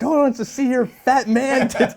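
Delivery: high-pitched comic scolding; mock berating. Boss berating a stripper over his body, high mock scolding. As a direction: imitate someone tearing into an employee. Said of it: "he's still being berated".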